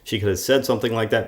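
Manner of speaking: ordinary talk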